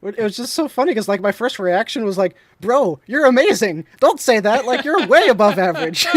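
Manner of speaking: high, loud, laughing hype; bursting with amused encouragement; shouting praise through laughter; excited laughing. Real, not acted.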